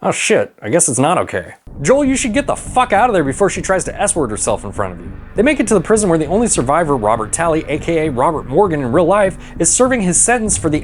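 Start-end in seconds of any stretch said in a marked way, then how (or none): none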